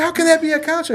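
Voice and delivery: high, loud puzzled question; hammed-up incredulous question; disbelieving coworker.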